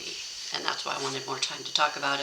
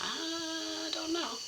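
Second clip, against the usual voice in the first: hesitant doubt; 12 semitones higher, 8 dB quieter, much slower than usual. Retold or imitated. Daughters urge her, she hesitates.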